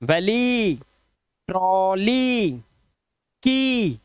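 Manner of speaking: वैली, ट्रॉली, की drawn out on the final "ee"; long "ee" endings. Shown as a demonstration. Teacher reading EY words aloud, slow long "ee" on ट्रॉली. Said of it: "EY का उच्चारण क्या आ रहा है?".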